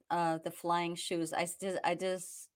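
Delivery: ordinary talk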